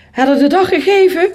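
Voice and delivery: loud, high-pitched alarmed question; urgent question. A narrator's reading. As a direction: demand an answer from the professor. Child urgently questions the professor. (luid en dringend vragend)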